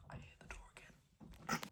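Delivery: barely audible whispered aside; hushed, wary aside; furtive whisper. Real, not acted. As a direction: whisper so nobody outside hears. Worried about being overheard.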